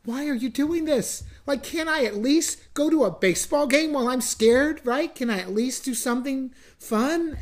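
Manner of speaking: high, pleading mock shout; half-acted cry of frustration at God. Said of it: "I just wanted to shout to God".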